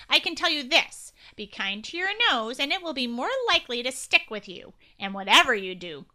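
loud nasally agent voice. Acted. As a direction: push it all through the nose, official. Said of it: "said in a nasally voice".

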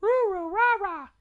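put-on very high, loud baby babble; babbling baby. A narrator's reading. Baby Maggie copying her family, high loud babbling. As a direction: squeal out nonsense syllables like a baby. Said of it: "And Maggie said".

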